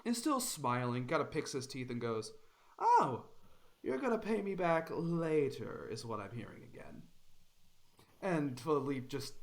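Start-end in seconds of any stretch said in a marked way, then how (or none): 2.8-7.0 mocking, sing-song menace. Bandit mocks Philippe.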